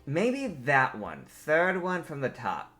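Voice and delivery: slow, deliberate suggestion; leaning-in suggestion. Acted. Hysis beside Dragos looking skyward, slow deliberate suggestion. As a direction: offer it slowly, word by word. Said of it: "leans in, like, kinda puts her face to yours".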